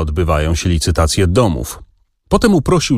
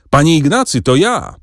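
shouting in his ear; 6 semitones higher than usual. A narrator's reading. The visitor shouts into the clerk's ear, a mild raised call. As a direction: startle him with a cheerful shout. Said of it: "krzyknął mu w ucho".

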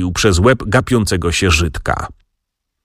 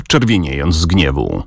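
plain reading voice

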